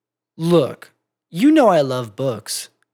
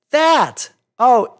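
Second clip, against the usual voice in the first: high sharp exclamation; surprised realisation; 8 semitones higher, 5 dB louder than usual. Acted. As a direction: it suddenly clicks. Sudden recognition.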